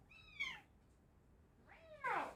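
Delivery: tiny high mew at the cat; high soft meow. Pretend. Owner mewing softly to her cat Milo, high and light.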